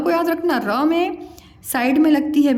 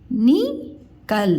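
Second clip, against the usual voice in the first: निकल split slowly into नि and कल; syllable by syllable; much slower than usual. Shown as a demonstration. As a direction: sound out निकल with a pause after नि. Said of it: "उच्चारण ऐसे में करके बताया करूँ". (निकल को अलग-अलग तोड़कर, धीरे)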